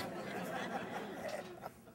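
breathy burst of laughter; a quick airy laugh. Real, not acted. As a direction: laugh at your own joke. Laughs after joke about drinks.

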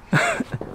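a squeaky little laugh; tiny high laugh; high breathy giggle. Real, not acted. Laughs ending the clip.

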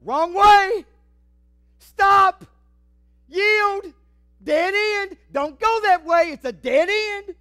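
high, full-force warning shouts; God screaming. Acted. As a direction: bellow each warning like a road sign. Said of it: "Can you hear God scream?".

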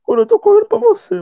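very high, loud panic; panicked shout. Real, not acted. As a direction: scream to your partner for help. Fleeing monster, calling partner.

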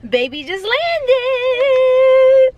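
a slow, high, stretched singsong; excited. Real, not acted. Wife announcing husband's landing to viewers, slow high singsong. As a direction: draw it out high and delighted. Husband's plane just arrived.

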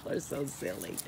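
ordinary talk

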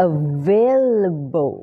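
"available" very slow, long stressed A in "vail"; A-sound pronunciation. Shown as a demonstration. Teacher modelling the A sound for learners, slow drawn-out pronunciation. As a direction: stretch the A in "available". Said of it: "เป็นเสียง A นะ".

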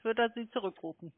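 ordinary talk

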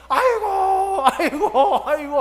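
high, loud hammed-up wailing; imitated shouting. Imitating worshipper's cries all through prayer, loud wailing. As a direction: wail it out comically at full voice. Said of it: "하고 소리를 지르는 거예요".

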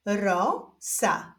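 stretched first syllable, pause, then second; drawn-out, divided syllables; syllable reading. Shown as a demonstration. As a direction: read it slowly as two separate syllables. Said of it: "doczytujemy sylabę różową".

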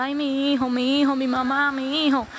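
drawn-out wailing lament, mildly acted; a touch of a tearful wail; imitated crying. Man crying below in the boat, imitated lament. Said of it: "Está llorando".

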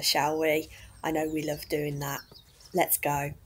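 ordinary talk, nothing marked

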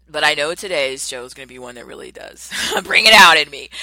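real excited outburst; excited laugh. Real, not acted. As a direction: let the emotion spill over, loudly. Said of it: "getting emotional".

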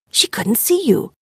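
breathless unvoiced whisper of realization; shocked whisper. A narrator's reading. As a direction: whisper it as the truth sinks in.

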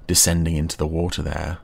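usual speaking voice

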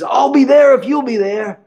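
put-on delighted old teacher. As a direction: greet a favourite student on the phone with joy. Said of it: "He says".

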